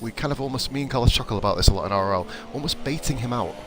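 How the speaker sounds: ordinary talk, nothing marked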